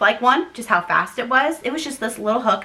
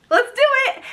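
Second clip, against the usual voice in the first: high-pitched, gung-ho midwife imitation; mock overeager midwife. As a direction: imitate someone far too keen to start.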